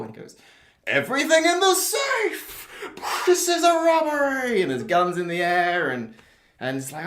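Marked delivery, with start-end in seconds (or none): 0.9-4.6 high, slow hammed-up robber yell; robber shouting. Robber shouting at the bank teller, high slow hammed-up yell. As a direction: bellow the demand at the teller like a cartoon villain. Robber voiced in plot pitch.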